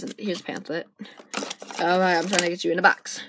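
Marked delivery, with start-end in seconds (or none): none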